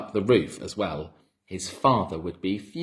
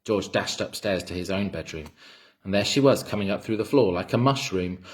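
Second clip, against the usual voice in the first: plain reading voice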